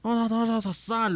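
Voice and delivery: high, pestering mock voice; amiga insistente imitada. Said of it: "porque te dicen así". (imitación aguda e insistente)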